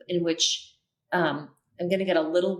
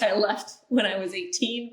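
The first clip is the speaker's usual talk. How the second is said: laughing; 3 semitones higher than usual. Real, not acted. Laughing about her fading Minnesota accent.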